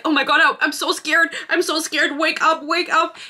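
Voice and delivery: mock panic. Retelling the hero panicking over her cut, in a hammed-up scared voice. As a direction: ham up his fake fright over a tiny cut.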